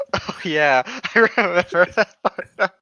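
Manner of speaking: words broken up by real laughter; laughing. Real, not acted. Laughing at absurd story detail.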